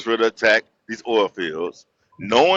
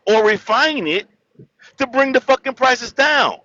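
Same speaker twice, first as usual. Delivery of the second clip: heated rant; 8 semitones higher than usual. Real, not acted. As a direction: pitch climbs high, rising outrage. Ranting about bombing oil fields.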